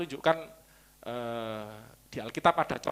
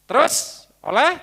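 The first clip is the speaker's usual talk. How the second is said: high, slow rising prompt on oleh; prompting question; 11 semitones higher, 8 dB louder, slower than usual. Acted. Preacher prompting the congregation to finish the verse, rising. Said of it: "prompting congregation to continue verse".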